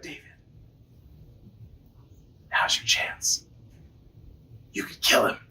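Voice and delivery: urgent secret whisper; hushed whisper, carefully spaced out. Retold or imitated. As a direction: whisper it so nobody nearby overhears. Secret urging in the cave.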